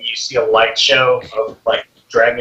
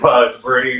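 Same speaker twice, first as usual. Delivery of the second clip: ordinary talk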